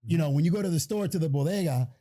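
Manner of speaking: ordinary talk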